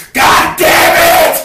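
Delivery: high, loud, slow screamed curse; a real drawn-out scream of rage at God; furious scream. Real, not acted. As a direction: let the curse tear out of you. Cursing God over rejection.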